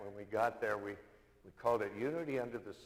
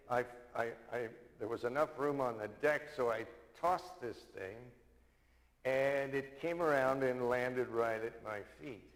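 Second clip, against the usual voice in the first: usual speaking voice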